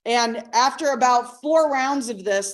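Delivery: usual speaking voice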